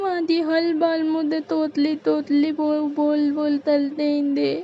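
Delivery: whimpering, hurt lisp; wounded childlike bahu, mock whimper; lisping daughter-in-law. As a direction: complain tearfully in babyish consonants. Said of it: "तोतली बहू".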